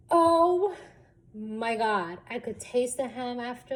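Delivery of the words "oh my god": mock horror. Recruit finding ham in the egg, mock horror. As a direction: stretch the "oh" in retold horror.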